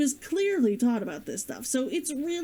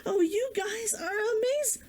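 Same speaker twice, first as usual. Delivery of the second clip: gushing; 9 semitones higher than usual. Real, not acted. Gushing thanks to viewers.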